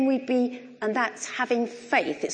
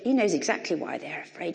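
ordinary talk

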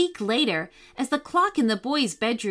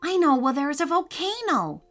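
put-on high, keen child voice; eager boy; 7 semitones higher, faster than usual. A narrator's reading. Calvin suggests a hike to Mickey, high and eager. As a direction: pitch the idea like an excited kid. Voicing a boy pitching his idea.

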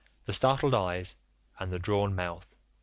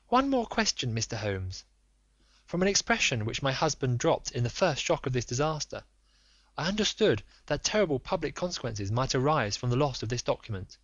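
high, urgent, imploring lady; a proud wife begging for the truth; pleading noblewoman; 4 semitones higher than usual. Acted. Lady questions Holmes.

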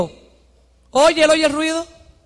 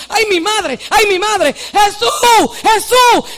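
high, excited calls to Jesus; crying out; 8 semitones higher, 5 dB louder, much slower than usual. Acted. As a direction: shout from your place as the blind man. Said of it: "empezó a gritar de su sitio".

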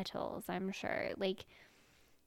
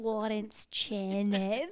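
put-on very slow Scottish accent; Lawrence Chaney; much slower than usual. Podcast host repeating her impression for co-host, drawn-out Scottish accent. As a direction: draw the name right out with a Scottish lilt. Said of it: "That's pretty good".

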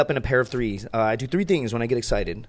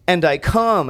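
higher, louder announced punchline; punchline delivery; 6 semitones higher, 5 dB louder, much slower than usual. Retold or imitated. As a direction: land the retold punchline up high and loud. Punchline retold among high friends.

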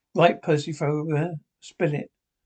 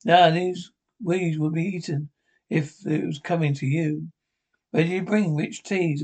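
usual speaking voice

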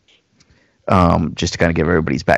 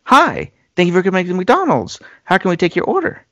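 higher, perky recorded drive-thru greeting; imitating the recorded greeting; 10 semitones higher than usual. Caller at the drive-thru hears a bright recorded greeting. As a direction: play the cheery canned voice. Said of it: "and it's like".

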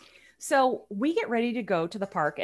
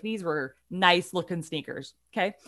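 ordinary delivery

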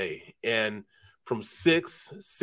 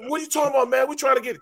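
high protest; 12 semitones higher, faster than usual. Retold or imitated. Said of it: "I said".

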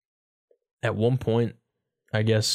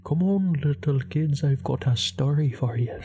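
higher, slow put-on storyteller voice; mock children's storyteller; 4 semitones higher than usual. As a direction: lure the little kids in with mock storytime. Mock storyteller calling kids to bedtime tale.

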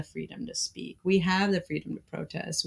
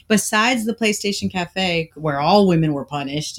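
normal talking voice

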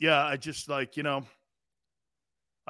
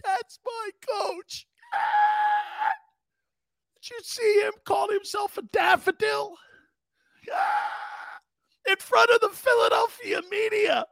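tearful, very high-pitched wail; hammed-up crying in defence of the coach. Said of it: "um, a T O. You ready?".

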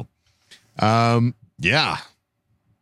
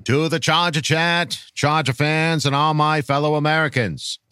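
put-on measured, old-fashioned presidential speech delivery; grand political orator; 5 semitones higher than usual. Reading a fan's rallying question to Charger Nation, grand oratory. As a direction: deliver a solemn, rousing address with dropped R's.

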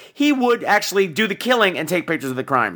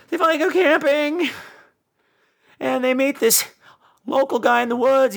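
usual speaking voice

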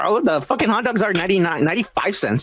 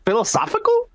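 pitched way up, mock-baffled; puzzled mock quote; 10 semitones higher than usual. Retelling a fan's odd tweet, mock-baffled and high. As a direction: ham up a confused reply, high. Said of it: "And I was like".

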